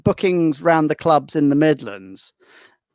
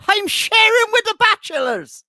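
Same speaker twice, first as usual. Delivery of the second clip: much higher, proud comic voice; Carson punchline; 3 dB louder than usual. Retold or imitated. Carson reveals why the room is cheap, in a much higher comic voice. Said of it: "He said".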